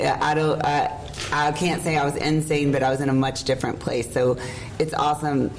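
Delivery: normal talking voice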